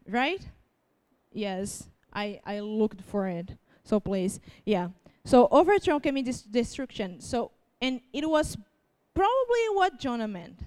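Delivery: normal talking voice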